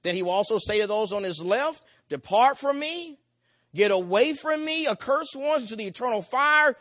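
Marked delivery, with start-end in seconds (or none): 2.1-6.8 stern condemnation. Acted. The judge sending away those on his left, higher, louder and stern. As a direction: banish them with the words.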